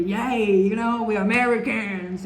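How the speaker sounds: mock American enthusiasm, loud. Vlogger imitating loud Americans, mock cheery and loud. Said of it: "And Americans are very".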